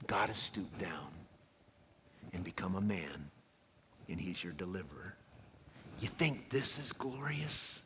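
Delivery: soft, low, near-whispered confiding; hushed angel. Acted. Angel pointing shepherds to the babe in Bethlehem, hushed. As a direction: drop to a hush, as if sharing something precious.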